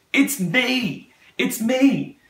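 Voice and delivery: high-pitched, moved voice of the guard; retold realisation. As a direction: imitate her high, overcome realisation. Guard realising prayer is for her.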